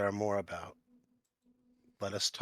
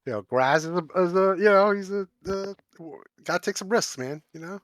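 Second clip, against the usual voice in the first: excited; 10 semitones higher, 11 dB louder than usual. Acted. Player voices his orc.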